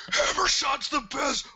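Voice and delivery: high, loud commercial pitch; hyped ad shout. Retold or imitated. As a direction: yell it like a TV ad. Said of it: "You're like".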